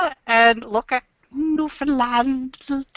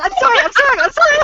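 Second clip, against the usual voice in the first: high, loud, escalating mock apologies; frantic apologizing; 13 semitones higher, 5 dB louder, much faster than usual. Canadian told to stop apologizing, helplessly apologizing louder. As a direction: pile up sorries in hammed-up panic.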